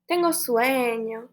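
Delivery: ordinary talk, nothing marked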